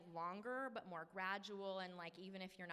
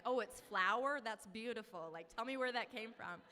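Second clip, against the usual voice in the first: retold sociable gushing; 7 dB louder than usual. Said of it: "like, so social all day".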